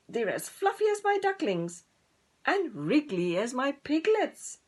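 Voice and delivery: oinking pig. Acted. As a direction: oink it out admiringly. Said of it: "oinked Peggy".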